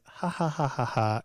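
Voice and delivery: loud, spoken-out fake laugh; mock laugh. Husband scoffs at his wife's plan, retold mock laughter. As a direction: laugh in mockery, each ha separate.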